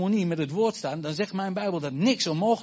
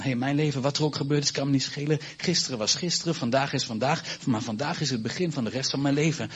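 ordinary delivery

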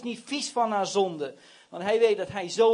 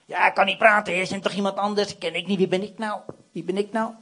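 hammed-up reluctant objection; protesting excuses. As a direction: reel off excuses to get out of it. Acting Moses making excuses to God.

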